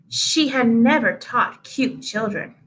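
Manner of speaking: usual speaking voice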